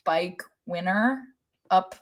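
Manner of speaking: normal talking voice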